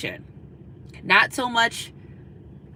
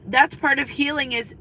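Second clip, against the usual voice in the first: usual speaking voice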